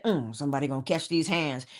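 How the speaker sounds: low, muted mock threat; grumbling threat. Imagined grouch getting up in the morning, grumbling threat. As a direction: play waking up itching for a fight, pitched low. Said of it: "if you get up and you say".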